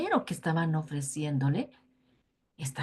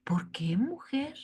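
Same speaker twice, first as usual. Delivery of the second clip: soft question; 5 semitones higher, 5 dB quieter than usual. A narrator's reading. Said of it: "Sansón le dice".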